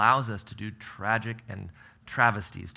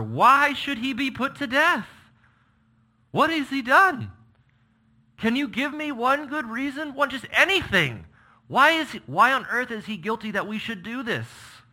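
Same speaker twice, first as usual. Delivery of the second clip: very high, loud desperate son; a son pleading with his father; anguished plea; 14 semitones higher, 7 dB louder than usual. Acted. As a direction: beg for one reason. Jonathan's anguished protest.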